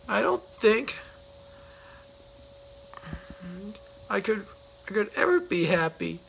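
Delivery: a moping whine that keeps stalling; miserably. Acted. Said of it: "he added miserably".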